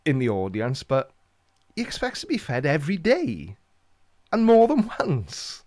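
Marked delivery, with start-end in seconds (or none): 1.7-5.6 high-pitched, incredulous comic complaint, slowing at the end; mock outrage. Dad to other parents about feeding his son, mock outrage. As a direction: squeal it in pretend disbelief.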